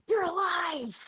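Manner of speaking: high, loud acted shout; excited yell. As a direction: yell it out in amazed relief. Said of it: "looks at you and yells".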